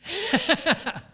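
high loud burst of laughter. Real, not acted. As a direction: laugh out at the punchline. Laugh at own joke.

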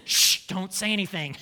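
mock hush, a lightly changed voice; a relative shushing the gossip, lightly played. Relatives hushing talk at a family gathering, mock hush. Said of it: "everybody's talking about it".

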